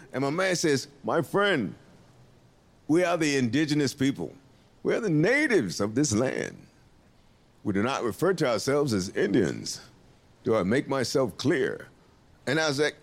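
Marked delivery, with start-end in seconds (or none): 1.0-11.9 low, measured, dignified voice; solemn stern correction. Retold or imitated. Native man corrects the comic, low and solemn.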